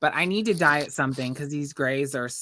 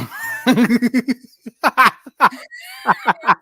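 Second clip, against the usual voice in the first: high, loud cackle; loud cackling laugh; 7 semitones higher, 8 dB louder than usual. Real, not acted. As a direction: cackle loudly. Laughing at crude joke.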